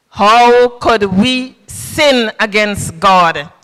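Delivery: ordinary delivery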